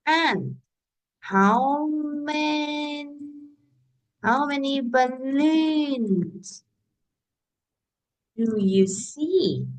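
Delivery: teacher modelling a question slowly for pupils; slow model question. Shown as a demonstration. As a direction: spell it out slowly like modelling for beginners. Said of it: "You can say".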